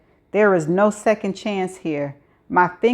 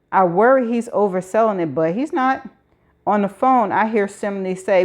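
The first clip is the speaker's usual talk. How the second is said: usual speaking voice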